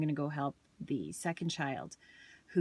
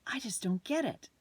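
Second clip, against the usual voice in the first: put-on a higher, whiny kid's voice; frustrated child; 7 semitones higher than usual. Daughter telling mom she just doesn't get it, whiny. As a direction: sound like a stuck kid giving up. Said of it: "this child of mine said".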